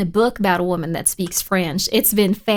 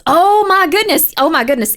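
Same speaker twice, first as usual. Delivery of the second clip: real thrilled surprise; startled excitement; 6 semitones higher, 7 dB louder than usual. Real, not acted. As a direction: burst out in amazed discovery. Startled by a light anomaly.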